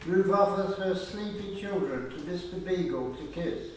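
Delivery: ordinary delivery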